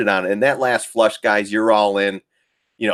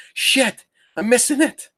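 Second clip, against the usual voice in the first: high, squeaky mock alarm; mock frantic trader pitched way up; frantic fomo. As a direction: yelp it like you just missed the rally. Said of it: "here it's like".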